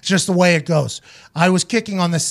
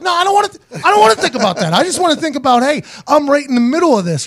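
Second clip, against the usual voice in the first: higher, hurried pleading; mock panicked protest; 6 semitones higher, faster than usual. Kicker pleading with the snapper, mock panic. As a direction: beg him to stop putting it in your head. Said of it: "I was like".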